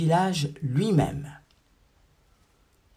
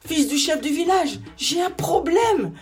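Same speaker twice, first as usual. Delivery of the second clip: much higher, pleading little creature voice; small salamander; 12 semitones higher, 3 dB louder than usual. A narrator's reading. Salamander begging the chief's son, high and desperate.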